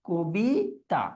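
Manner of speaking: কবিতা drawn out as "ko-bii... taa"; closed, syllable by syllable. Shown as a demonstration. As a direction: model কবিতা slowly with a closed o. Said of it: "শব্দটা কি হবে উচ্চারণের ক্ষেত্রে".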